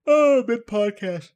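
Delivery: talking through a yawn; real yawn mid-sentence. Real, not acted. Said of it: "here comes the yawn".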